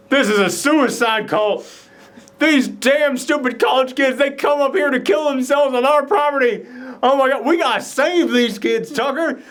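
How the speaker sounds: high-pitched, urgent outburst; mock alarm. Dale tells Tucker about the suicide cult, high mock alarm. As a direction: act out a panicked, wildly mistaken realisation.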